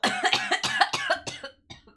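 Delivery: loud, long real coughing fit; dramatic coughing fit. Real, not acted. Sick streamer greeting viewers, loud coughing fit. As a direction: break into loud, helpless coughs. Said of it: "It sounds like I'm being so dramatic".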